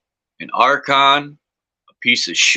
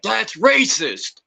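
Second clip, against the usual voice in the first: high, drawn-out mock protest; slow, high-pitched pretend outrage; mock indignation; 10 semitones higher, much slower than usual. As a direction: play offended, stretching the words. Jokey protest at being called American.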